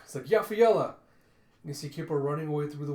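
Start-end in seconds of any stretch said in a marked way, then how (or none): 0.2-0.9 high, loud yell of a name; urgent calling out. Acted. Dia calling Yafaela as the fox escapes, urgent shout. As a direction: holler for a companion. Calls to companion during a chase.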